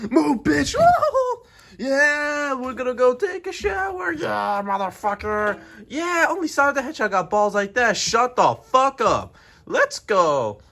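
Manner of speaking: loud, high-pitched bragging; hyped boasting. Acted. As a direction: crow with hype before the big ride. Hyped-up character boasts before the ride.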